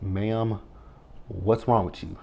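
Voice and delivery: hushed incredulous ma'am; mock-calm ma'am. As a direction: talk her down like she has lost it, quietly. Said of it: "I was like".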